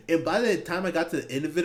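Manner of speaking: ordinary delivery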